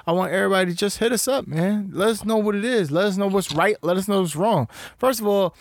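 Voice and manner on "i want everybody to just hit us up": ordinary talk, nothing marked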